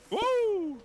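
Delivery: high, loud drawn-out "woo"; excited whoop. Real, not acted. Player cheering the lettuce order with mates, excited whoop. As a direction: let out a high celebratory whoop. Celebrating during the game.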